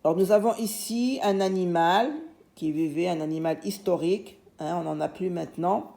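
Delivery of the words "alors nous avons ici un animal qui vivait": ordinary talk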